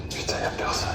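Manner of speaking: hushed whisper, barely voiced. Real, not acted. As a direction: whisper so nobody hears. Searching an empty room quietly.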